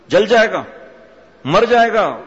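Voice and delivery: a preacher thundering the warning a second time. Acted. Scolding warning repeated.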